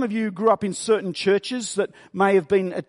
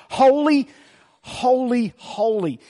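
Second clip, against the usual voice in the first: first "Holy" loud and high, the next two falling away; emphatic triple holy; 5 semitones higher, 4 dB louder, slower than usual. Shown as a demonstration. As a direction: punch the first "Holy" hard, then let it fade. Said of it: "There is a real emphasis".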